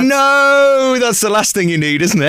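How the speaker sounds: high, startled no; shocked protest. Retold or imitated. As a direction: recoil in mock horror. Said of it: "he's like".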